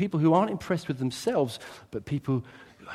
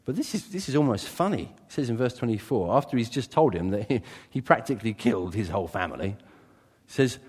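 ordinary delivery

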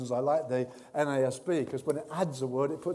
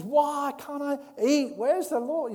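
tempted Jesus; 9 semitones higher, 6 dB louder, slower than usual. Acted. Jesus voiced tempted in desert.

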